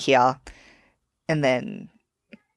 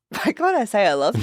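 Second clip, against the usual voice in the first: puzzled; 6 semitones higher than usual. Retold or imitated. Retelling puzzled mirror moment.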